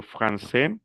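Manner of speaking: "francés" ending on an open é, no s; silent final S. Shown as a demonstration. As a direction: leave the s off "francés". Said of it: "sin la S". ("francés" sin la s final)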